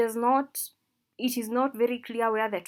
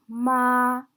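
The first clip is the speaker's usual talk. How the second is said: flat, sung-like "ma"; first tone; 8 dB louder than usual. Shown as a demonstration. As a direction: stretch "ma" on a steady note. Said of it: "when we indicate the four tones".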